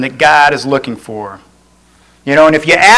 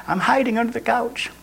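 soft, high hiding voice; hushed hider; 7 semitones higher, 11 dB quieter than usual. Acted. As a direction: answer secretly, as if tucked out of sight. Playing the hider answering softly.

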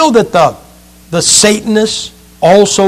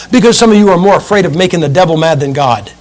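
normal talking voice

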